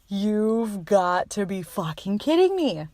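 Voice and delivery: slow stunned disbelief. Retold or imitated. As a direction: drag it out in shock. Retold shock at positive test.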